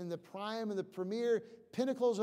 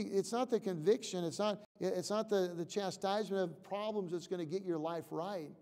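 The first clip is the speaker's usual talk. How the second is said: ordinary delivery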